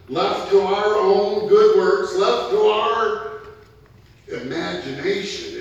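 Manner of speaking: loud, rising preacher's call; fiery preaching. Acted. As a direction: preach it hard to the congregation. Preaching at full force.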